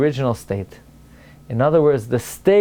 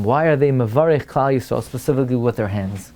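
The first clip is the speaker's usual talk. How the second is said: ordinary talk, nothing marked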